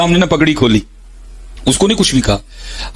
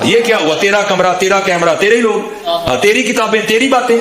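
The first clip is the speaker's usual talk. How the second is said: mocking taunt; 6 semitones higher than usual. Mocking a rival's room and camera.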